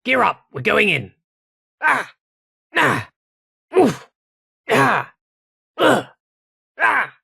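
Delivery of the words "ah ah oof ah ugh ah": sharp high cries of pain; pain grunts. Acted. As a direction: take the hits. Soldier hit by gunfire.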